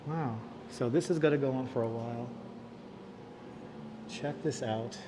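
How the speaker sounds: soft, awed, quiet voice; hushed and moved. Real, not acted. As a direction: speak quietly, touched by what you read. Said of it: "a lot more emotional than I thought".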